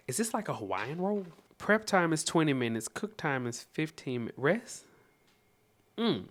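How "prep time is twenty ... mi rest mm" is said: ordinary talk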